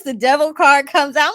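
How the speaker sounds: ordinary delivery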